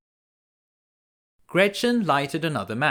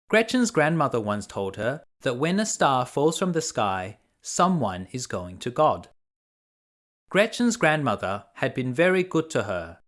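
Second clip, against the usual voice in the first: usual speaking voice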